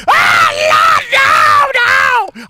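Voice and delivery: high, loud panicked scream. Retold or imitated. Preacher reliving the falling bike, high panicked scream. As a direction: scream it as the bike tips over. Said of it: "I'm screaming".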